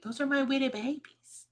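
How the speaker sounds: put-on high, cooing baby voice; pet baby talk. Defending talk to the cats, cooing baby talk. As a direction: coo it like you're talking to kittens. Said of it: "Only psychos don't change your voice".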